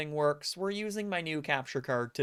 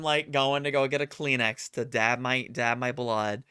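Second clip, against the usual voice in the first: normal talking voice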